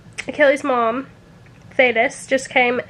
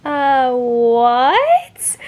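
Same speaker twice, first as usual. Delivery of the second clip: baffled what; 3 dB louder than usual. Retold or imitated. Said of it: "I was like".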